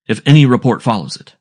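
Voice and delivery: cried out. A narrator's reading. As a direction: call out an urgent instruction. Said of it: "cried I".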